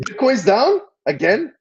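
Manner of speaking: high, slow, loud disbelief; surprised question. Real, not acted. Hearing Bitcoin news from co-host, surprised. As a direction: pitch it way up, incredulous.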